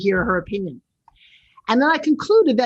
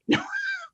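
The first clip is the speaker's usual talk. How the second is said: sudden high burst of laughter; cracking up at her own joke; 7 dB quieter than usual. Real, not acted. Host jokes about the spelling of his name, bursting out laughing.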